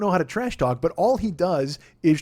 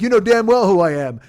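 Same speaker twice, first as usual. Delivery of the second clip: offended retort; 4 semitones higher, 9 dB louder than usual. Retold or imitated. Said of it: "Jeremy Stevens is like".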